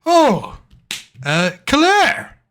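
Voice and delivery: put-on hearty innkeeper greeting an old friend; Toblin's voice. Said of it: "And he goes".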